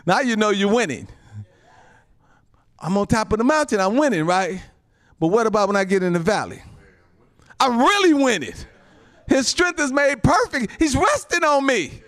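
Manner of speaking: high, loud, climbing preacher call; loud and high, hitting every phrase hard; full-force preaching. Acted. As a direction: lift the church up, shouting the victory.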